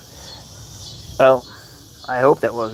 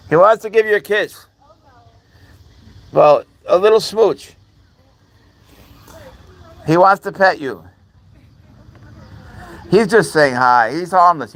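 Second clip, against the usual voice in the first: higher, louder cooing at a pet; pet-talk, high and sing-song; 7 semitones higher, 6 dB louder than usual. Real, not acted. Cooing to the cat.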